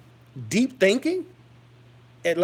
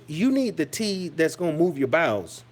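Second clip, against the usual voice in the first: ordinary talk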